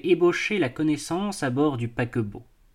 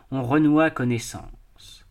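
ordinary delivery

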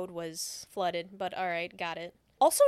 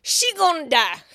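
mock dire pronouncement; 5 semitones higher, 10 dB louder than usual.

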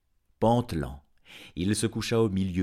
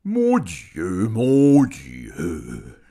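wailing dragon; 2 semitones higher, 12 dB louder, much slower than usual. A narrator's reading. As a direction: lament loudly as a dismayed dragon. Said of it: "s'écria le dragon". (dragon qui s'écrie, plainte forte et traînante)

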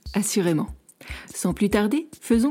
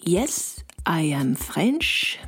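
put-on slow, hesitant French-accented English; slow, unsure reply lifting into a question; hesitant answer; much slower than usual. A narrator's reading. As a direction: draw it out slowly, tentative, rising at the end. Hesitant answer after a reverie.

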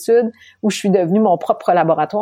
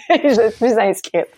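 laughing; 4 semitones higher than usual. Real, not acted. Rire en racontant l'inscription.

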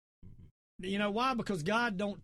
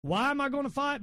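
ordinary talk, nothing marked

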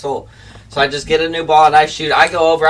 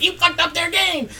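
high mock-whiny course manager; imitating the course guy in a high whine; 12 semitones higher than usual. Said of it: "He's like".

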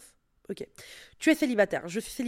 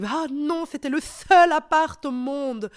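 mock despair; 5 semitones higher, 4 dB louder, slower than usual. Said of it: "Tu te dis".

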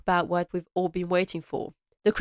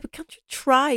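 pleading Robert; 7 semitones higher, 3 dB louder than usual. Retold or imitated. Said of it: "Robert's like".

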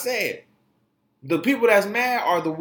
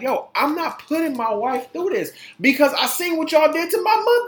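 protective husband; 9 semitones higher than usual. Retold or imitated. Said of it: "Prince Harry has something like".

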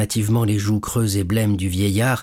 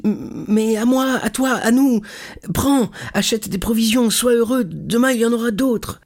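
put-on much higher, exuberant young man; joyful insistence; 13 semitones higher than usual. Son sharing his money with his father, exuberantly. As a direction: burst out generously, high and excited.

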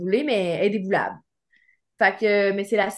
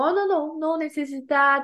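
flustered refusal; 6 semitones higher than usual. Retold or imitated. Refusing stranger's ride, flustered.

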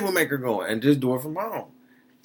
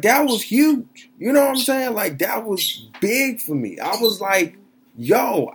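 excited; 9 semitones higher, 4 dB louder than usual. Real, not acted. Emotional gratitude to mom.

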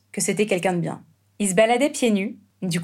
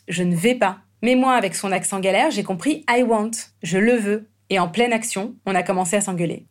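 ordinary talk

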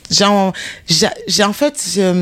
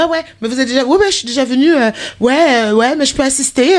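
eager high voice; 7 semitones higher, faster than usual. Retold or imitated. Herself eagerly answering, retold.

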